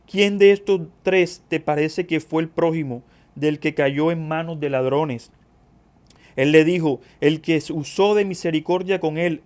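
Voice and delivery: declamatory scripture reading, punching key phrases. Acted. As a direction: proclaim the passage to the congregation. Reads scripture aloud to congregation.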